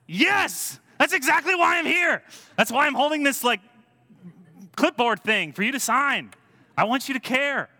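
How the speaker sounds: very high, hammed-up fervent yell; impassioned shouting. Greenpeace canvasser answering a doubtful neighbour, impassioned yelling. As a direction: shout it like an exasperated zealot.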